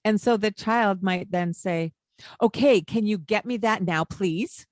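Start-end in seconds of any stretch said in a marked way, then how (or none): 2.4-4.7 pushy, louder, demanding "please"; impolite tone. Retold or imitated. Child demands something rudely, tacking on please. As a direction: tack please onto a rude demand. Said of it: "In a not polite tone of voice".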